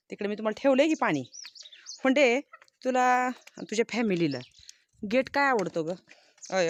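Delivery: loud, high calling to drive the goats off; shooing goats away. Real, not acted. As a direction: shout them away from the gate like a farmer.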